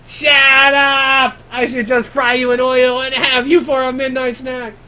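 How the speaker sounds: high, loud furious character shout; high, loud angry villain voice; angry monster. Acted. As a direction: bellow it like an offended beast. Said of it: "he gets angry".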